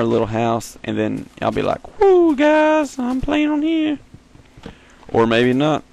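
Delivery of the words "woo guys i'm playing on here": very high, silly cheering voice; mock excitement. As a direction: imitate yourself whooping to viewers in falsetto. Imitates future self cheering on camera.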